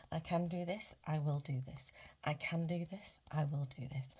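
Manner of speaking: normal talking voice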